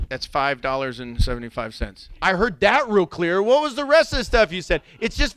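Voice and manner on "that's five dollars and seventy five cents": low mumbled drive-thru speaker voice. Retold or imitated. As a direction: lower it like a crackly order box.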